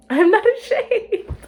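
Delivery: high, loud and laughing, hammed-up; laughing loud denial. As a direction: shout it through a laugh. Laughing over-the-top denial.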